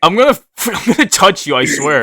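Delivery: high, loud, breaking into laughter; laughing mock threat. Real, not acted. Mock-threatening a friend mid-banter, laughing loudly. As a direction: threaten playfully while cracking up.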